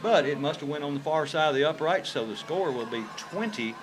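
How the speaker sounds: ordinary talk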